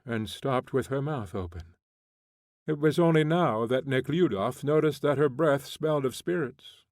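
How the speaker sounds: plain reading voice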